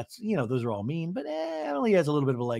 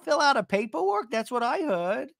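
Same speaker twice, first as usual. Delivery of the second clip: imitating Gina, higher and ditzy; a lightly voiced confused Gina; mock Gina voice; 6 semitones higher than usual. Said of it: "Gina goes".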